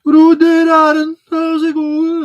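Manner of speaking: very high, loud mock Brummie; Birmingham accent. Streamer greeting Aaron in a caricature, high Brummie accent. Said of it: "some sort of racist Birmingham accent".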